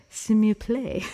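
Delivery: French phrase quoted with a giggle; amused French quote. Retold or imitated. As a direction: repeat the French phrase, breaking into laughter. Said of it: "when you said".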